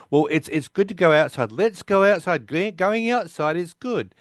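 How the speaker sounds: lightly acted, high persuasive plea; eager coaxing. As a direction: talk someone into it, pitch lifted. Voicing Raj coaxing Sheldon outside.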